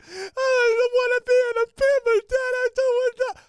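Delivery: hammed-up kid meltdown; wailing child. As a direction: bawl like an upset little girl. Daughter's tantrum acted out.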